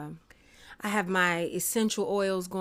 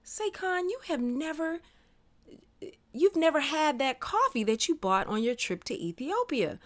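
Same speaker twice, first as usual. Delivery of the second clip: excited; 8 semitones higher than usual. Retold or imitated. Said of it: "And I said".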